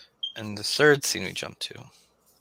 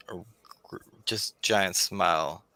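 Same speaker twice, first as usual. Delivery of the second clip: ordinary talk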